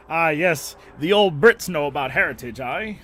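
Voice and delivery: loud, high hammed-up British accent; mock British accent. Mocking chat about heritage in a hammy British accent. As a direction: play an old Brit, loud and high. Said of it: "I don't know what accent that was".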